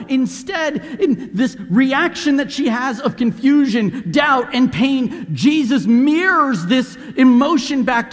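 loud, slow, impassioned preaching; swelling sermon climax, loud and slow; preaching at full force. Acted. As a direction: drive it home from the pulpit. Preaching peak.

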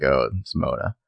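low, soft, underwhelmed reaction; nonplussed. Retold or imitated. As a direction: imitate someone quietly unimpressed. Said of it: "very nonplussed at this".

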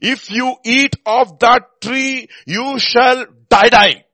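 high, slow, loud doubled die; God's stern warning, spelled out slowly; emphatic divine warning. Shown as a demonstration. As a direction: thunder the die, die. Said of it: "if you want to emphasize a truth".

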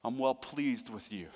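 slow, tender fatherly reassurance; kind voice. Acted. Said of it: "hear his kind voice this morning".

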